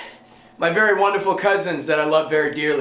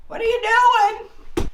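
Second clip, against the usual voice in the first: talking to the cat in a high sing-song; slower than usual. Real, not acted. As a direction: coo it to the kitty.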